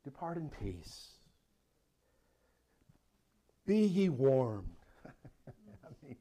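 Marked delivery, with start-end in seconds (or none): 0.0-4.8 slow, hollow pious voice; a light pious sneer; Pharisaical voice. Retold or imitated. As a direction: say it like a smug Pharisee. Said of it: "the Pharisaical voice".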